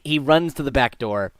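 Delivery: ordinary delivery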